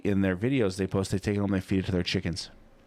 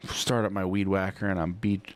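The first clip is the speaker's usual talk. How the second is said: ordinary delivery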